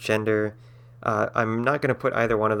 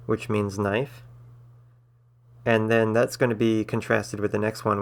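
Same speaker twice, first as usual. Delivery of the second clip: normal talking voice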